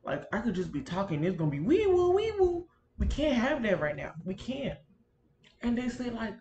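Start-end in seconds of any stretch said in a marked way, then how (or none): 1.6-2.7 high, wailing mock siren; ambulance siren imitation. Complaining about ambulances interrupting her talk, siren imitation. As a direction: mimic a siren, rising high on the repeat.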